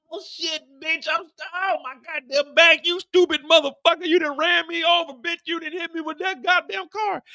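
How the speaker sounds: high, loud cursing in agony; the hitman hit by the car; pained yelling. Acted. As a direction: yell like you just got run over. Hitman run over by car.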